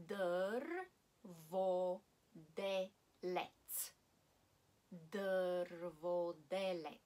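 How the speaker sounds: Drvodelec, very slow, R rolled in Dr; rolled R Bulgarian. Shown as a demonstration. Teacher spelling out Drvodelec for learners, slow and careful. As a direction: stretch out Drvodelec, trilling the R. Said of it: "R is R always, so you have to pronounce it".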